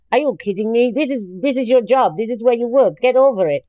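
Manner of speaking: hardworking coworker tells colleagues to get over it; tough scolding. Retold or imitated. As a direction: brush off the whinging, firmly.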